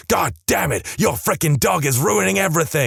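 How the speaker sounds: half-hearted angry outburst; retold angry outburst. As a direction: snap it out like a furious dad. Father's blow-up at the cake.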